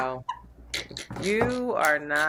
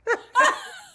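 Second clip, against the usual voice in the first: loud high laugh; 5 dB louder than usual. Real, not acted. Laughing at own impression.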